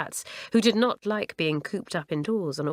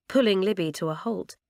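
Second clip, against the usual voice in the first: plain reading voice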